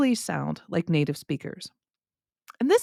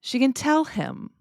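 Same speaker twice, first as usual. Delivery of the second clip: slow, higher "tell", light "can"; stress on TELL; 6 semitones higher than usual. Shown as a demonstration. Teacher tests listeners with a yes example, stress on "tell". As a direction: punch the verb "tell". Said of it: "where I put the stress".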